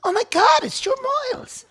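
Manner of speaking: very high squeal of an excited fan; a woman spotting a TV face, very high and starstruck; starstruck fan girl. Retold or imitated. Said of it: "some bird goes".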